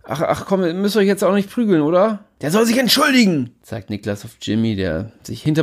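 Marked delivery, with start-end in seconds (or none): 0.0-2.2 nervous appeasing. Acted. Narrator calming a fight. 2.4-3.5 angry demand. Acted.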